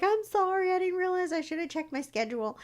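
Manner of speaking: high, sorry little voice; apologetic stylist. Retold or imitated. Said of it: "She was like".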